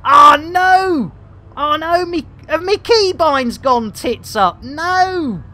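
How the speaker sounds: high, loud panicked cries; alarmed. Real, not acted. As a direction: panic at a sudden problem. Controls broke mid-game.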